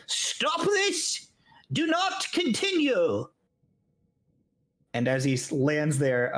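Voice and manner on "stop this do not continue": high, slow commanding call from afar; high stern stop order. Acted. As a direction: call out to halt the fight. GM voices unseen figure calling out.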